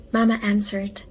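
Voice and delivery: ordinary delivery, nothing marked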